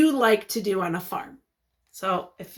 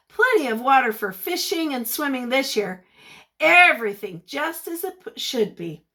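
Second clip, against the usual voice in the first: usual speaking voice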